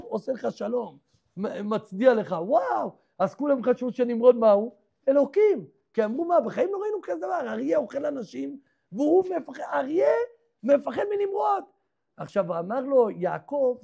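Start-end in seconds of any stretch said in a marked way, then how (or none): 6.2-11.6 amazed. Acted. People amazed at the lion.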